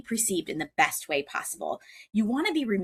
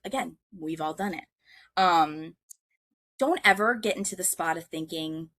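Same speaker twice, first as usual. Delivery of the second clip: normal talking voice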